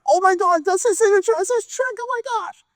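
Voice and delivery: very high-pitched astonished outburst; starstruck freak-out. Acted. Friend sees photo of the signed check, freaking out.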